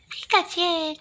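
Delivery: very high little creature voice; Pikachu. Acted. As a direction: squeak the name like a tiny pet. Pikachu says its name.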